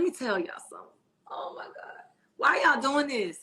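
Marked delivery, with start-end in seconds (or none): 1.3-1.7 breathless whisper to herself; whispered aside. Real, not acted. Flustered by chat flirting, whispering to herself. 2.4-3.4 higher, louder exasperated cry; exasperated outcry. Real, not acted. Flustered by flirting viewers, a higher, louder exasperated cry.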